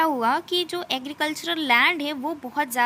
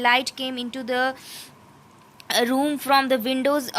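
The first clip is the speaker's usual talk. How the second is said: slow, deliberate reading of English text; slow textbook reading; much slower than usual. Acted. Teacher reading the textbook passage to students, slow and careful. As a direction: read it out word by word, slowly.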